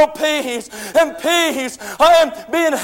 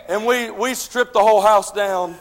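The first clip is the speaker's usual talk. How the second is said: ordinary delivery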